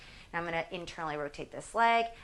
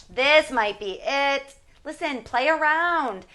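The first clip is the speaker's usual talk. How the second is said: strained, louder and higher, slow; straining under effort; 7 semitones higher, 9 dB louder, slower than usual. Real, not acted. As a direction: squeeze the words out mid-exercise. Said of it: "when I make these faces and talk like this".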